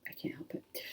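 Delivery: a quick whispered confession to herself; sheepish whisper. Real, not acted. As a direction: breathe it out as a tiny embarrassed whisper. Sheepish aside after mispronouncing.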